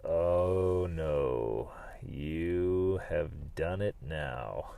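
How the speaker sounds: low, slow, soft dread; dismayed groan. Retold or imitated. Feeling the arm pop, low dismayed groan. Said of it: "And I was like".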